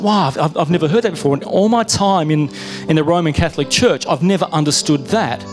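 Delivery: usual speaking voice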